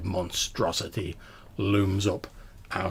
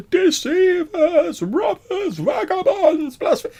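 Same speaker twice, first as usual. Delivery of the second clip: high, loud, drawn-out wailing list; mournful disembodied voice; 11 dB louder, slower than usual. Acted. Game master as the crypt's voice answering a shout, mournful wailing scream. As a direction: scream the accusations as a ghostly wail. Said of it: "again, this voice takes".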